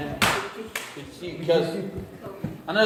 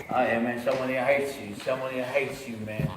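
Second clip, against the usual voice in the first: low, insinuating devil voice; inner devil; 6 semitones lower, 5 dB quieter than usual. Acted. Devil warning him on entering a room, low insinuation. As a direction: plant the doubt in a lightly voiced low devil. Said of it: "and my devil goes".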